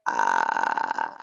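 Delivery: long, very low creaky "ah"; sustained low croak; vocal fry. Shown as a demonstration. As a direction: let the voice rattle on a held ah. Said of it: "So it sounds like".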